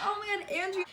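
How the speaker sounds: high-pitched fangirl gush; retold excitement. Retold excited reaction to meeting Andrew.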